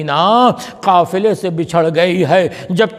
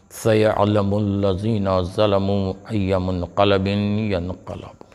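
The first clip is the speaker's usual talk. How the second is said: Quranic recitation; 11 semitones lower, 6 dB quieter than usual. Acted. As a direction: intone the verse softly as a solemn close. Closing Quran verse recited.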